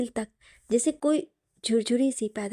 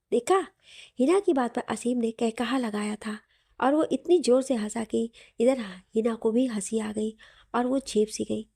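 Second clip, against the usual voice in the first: ordinary delivery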